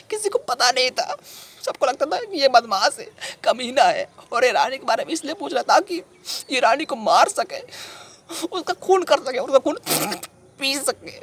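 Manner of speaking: high, agitated lament; anguished protest. Acted. Everyone thought he would kill Rani, anguished protest. Acted protest that friend was misjudged.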